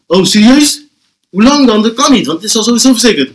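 high-pitched acted indignation; incredulous protest. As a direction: play the friend baffled by the police. Acts friend protesting to police. (gespeeld verbaasd protest)

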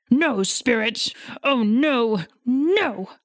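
rising, crying no spirit, voice cracking; anguished plea. Acted. Scrooge begs at his grave.

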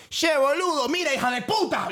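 exclamación argentina imitada, aguda; high-pitched mock Argentine outburst; acento argentino. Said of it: "habla argentino con tus tías". (acento argentino exagerado y agudo)

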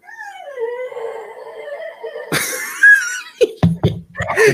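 high wheezing dying laugh. Real, not acted. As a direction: laugh like you are expiring. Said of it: "acting like he's dying".

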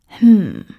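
loud grumbling hmm; grumpy, emphatic hum; grumbled hum. A narrator's reading. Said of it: "brummte er".

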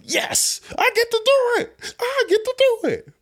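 squealing falsetto excitement; hammed-up squeaky delight; mock glee. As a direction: celebrate in a tiny, very high voice. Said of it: "Part of me was like".

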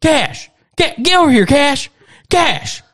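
Southern grandpa shouting for his dog; Southern granddad yelling. Retold or imitated. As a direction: holler across the yard at the dog. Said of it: "you just hear".

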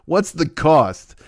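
slowed, baffled; deadpan slow what's the cost; slow puzzled question. Retold or imitated. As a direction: drag out the confusion. Confused retort.

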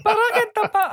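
high-pitched mock character voice; cookie thumper. Imitating a half-remembered film line, high silly voice. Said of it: "you doing cookie thumper".

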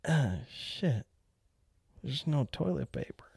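hushed, defeated whisper; whispered dismay. Retold or imitated. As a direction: breathe it out like a man stuck in a stall. Overheard man stuck without paper.

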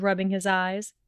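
ordinary delivery

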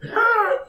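high yawning squawk; a sleepy bird's yawn; raven yawn squawk. Acted. Raven yawns.

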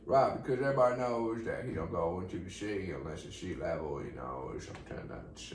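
put-on mellow star voice; laid-back celebrity impression. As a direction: ramble like a mellow movie star. Stays in the drawling character.

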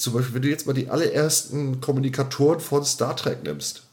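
ordinary talk

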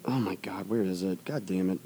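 whispered curse under breath; hushed frustrated mutter; whispering. Real, not acted. Searching, muttering to himself.

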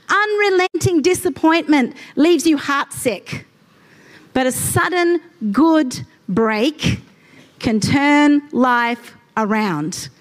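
slow deliberate reading; scripture reading. Acted. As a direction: read it slowly with weight. Reads scripture slowly.

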